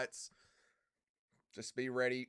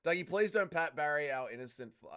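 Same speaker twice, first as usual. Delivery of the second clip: ordinary delivery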